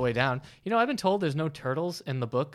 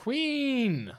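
grand proclamation; 12 semitones higher than usual. Pretend. Proclaiming the queen.

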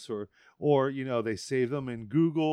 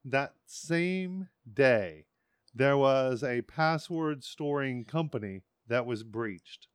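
slow emphatic; much slower than usual. Real, not acted. Building a point, slowing.

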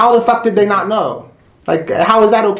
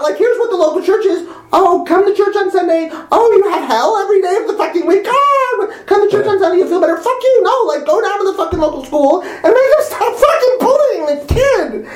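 high, mocking church, laughing in fury; angry rant; 12 semitones higher than usual. Real, not acted. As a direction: rant on at a raised pitch. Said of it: "It's like, pissing me off so hard".

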